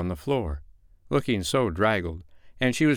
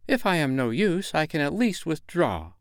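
high, dignified withdrawal; pitched-up wounded hauteur; haughty; 8 semitones higher than usual. A narrator's reading. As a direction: announce it with offended dignity. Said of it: "she replied with hauteur".

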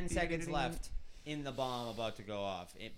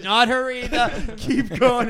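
laughing heckler quote; 6 semitones higher, 12 dB louder, slower than usual. Retold or imitated. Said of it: "He goes".

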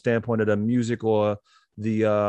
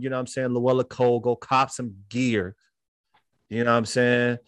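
normal talking voice

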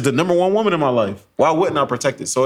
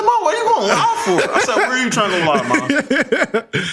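high, laughing exasperation; hammed-up annoyed son; irritated disbelief; 12 semitones higher than usual. Son questioning his mom about going live, exasperated. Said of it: "I was so irritated".

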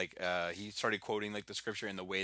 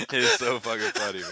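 laughing; 8 dB louder than usual. Real, not acted. Cracking up.

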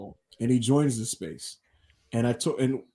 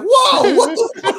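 high, loud mock outrage breaking into laughter; shocked outburst; 8 dB louder, slower than usual. Whole space reacting to the prank, mock-shocked yell. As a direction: yelp it as a stunned crowd, laughing.

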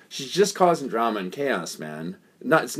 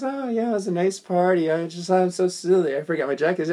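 lightly imitated woman, pitched higher; mock innocent woman; 6 semitones higher than usual. Retold woman excusing the left-behind jacket, mock innocent. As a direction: play her innocent reply, a little high.

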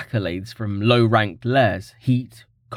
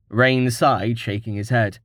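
usual speaking voice

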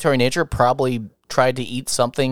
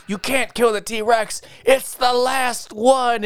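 high, drawn-out mock scream at normal volume; retold screaming; 10 semitones higher, much slower than usual. Movie characters pleading for the T-Rex, mock screaming. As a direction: imitate a movie character's desperate cry, half-hearted. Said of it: "they just go off screaming, going".

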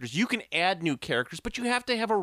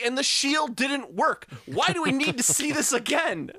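animated rant; 9 semitones higher than usual. Real, not acted. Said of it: "my animation".

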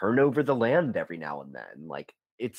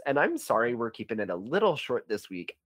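ordinary talk

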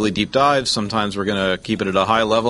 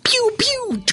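laser noises. Pretend. Mock action movie intro.

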